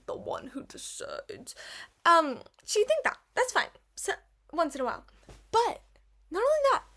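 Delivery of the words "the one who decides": breathy whispered boast, trailing off; whispered aside. Real, not acted. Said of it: "aside about being driver".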